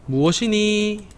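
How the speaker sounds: high, slow 무엇이니 with a question lift; teacher models the question 무엇이니 for kids; questioning tune. Shown as a demonstration.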